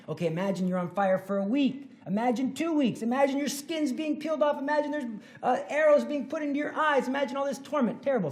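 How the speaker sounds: rising urgent preaching; building intensity. Real, not acted. Escalating hell description.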